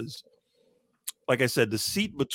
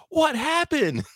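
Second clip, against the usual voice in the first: put-on high squeaky kid voice, pitched way up; kid blurting out; slower than usual. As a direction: blurt it like a confused little kid. Kid yelling out in quiet movie.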